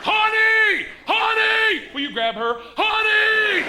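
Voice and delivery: repeated shouting. Retold or imitated. Calling wife in store.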